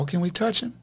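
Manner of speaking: lightly voiced eager little girl; imitating an eager girl. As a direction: voice the little girl asking sweetly. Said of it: "you hear the girl in the background talking".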